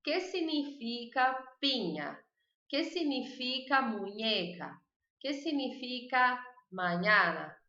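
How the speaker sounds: que significa said slowly, clearly; careful teacher drill; slow model questions. Shown as a demonstration. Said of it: "e bota a palavra".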